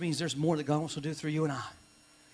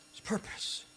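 whispered, much softer; hushed echo; 10 dB quieter, slower than usual. Shown as a demonstration. Pastor feeding the crowd a response, whispered. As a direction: breathe it back softly. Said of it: "Somebody say".

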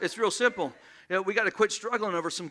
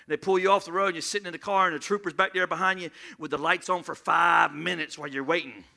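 ordinary talk, nothing marked

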